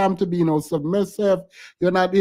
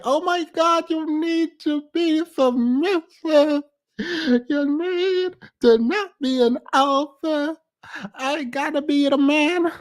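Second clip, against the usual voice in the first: mock whiny man voice; 8 semitones higher than usual. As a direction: drag it out like a sulking boyfriend. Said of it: "What is that voice?".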